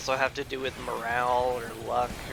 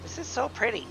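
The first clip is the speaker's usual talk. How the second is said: gushing; 11 semitones higher than usual. Real, not acted. Gushing over pretty scenery.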